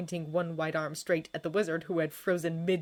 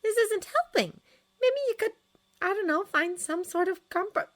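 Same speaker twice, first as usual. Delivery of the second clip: very high-pitched peacemaking friend; high, hesitant, placating suggestion; anxious friend; 5 dB louder than usual. A narrator's reading. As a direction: fret in a high little voice. Friend tries to keep peace.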